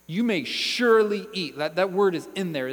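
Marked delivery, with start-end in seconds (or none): 0.1-1.6 SURELY punched loud and raised; emphatic command, leaning hard on "surely"; stress on SURELY. Acted. Preacher showing how God emphasised the command, stressing "surely". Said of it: "He emphasized".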